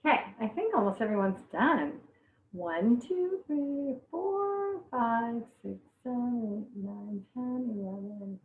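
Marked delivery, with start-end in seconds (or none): 2.5-8.4 soft, drawn-out counting; counting to herself. Real, not acted. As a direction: count softly under your breath. Counting finished viewers to herself.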